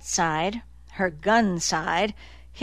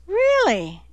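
high, incredulous question; surprised; 11 semitones higher, 4 dB louder than usual. A narrator's reading. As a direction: feign surprise, pitch jumping up. Annie's surprised reply.

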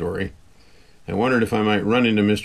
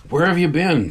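a higher, louder urgent hiss, not whispered; 9 semitones higher, 4 dB louder than usual. A narrator's reading. Tim demanding where she vanished to, sharp higher hiss. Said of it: "Tim hissed at me".

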